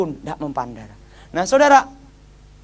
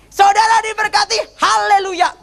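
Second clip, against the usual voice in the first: high, ringing preacher's blessing; pendeta berkhotbah; 13 semitones higher, 3 dB louder than usual. Retold or imitated. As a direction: imitate a preacher proclaiming a blessing. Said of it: "Dia ngomong gini". (menirukan seruan berkat pendeta bernada tinggi)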